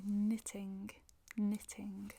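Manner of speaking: "knitting" with the T clearly sounded; careful standard "knitting", T pronounced; formal pronunciation. Shown as a demonstration. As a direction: say "knitting" formally, crisp T in the middle. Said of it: "formelle de le dire".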